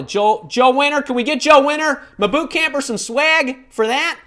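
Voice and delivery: excited call-out. Real, not acted. Calling out a viewer.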